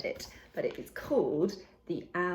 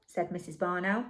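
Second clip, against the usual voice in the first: ordinary delivery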